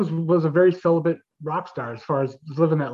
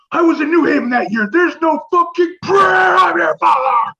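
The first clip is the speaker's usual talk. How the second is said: loud, high frantic denial; imitated full-blown furious yelling; 10 semitones higher, 8 dB louder than usual. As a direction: scream it in outrage, stretching to a howl. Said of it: "bulging out and screaming".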